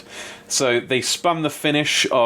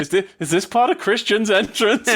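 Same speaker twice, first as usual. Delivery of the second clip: surprised announcer; 10 semitones higher than usual. Retold or imitated. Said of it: "He was like".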